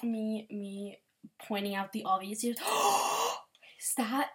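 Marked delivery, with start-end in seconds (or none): 2.6-3.4 sharp delighted gasp; quick intake of breath in delight; excited gasp. Real, not acted. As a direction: gasp at the picture. Sees fan art, gasps.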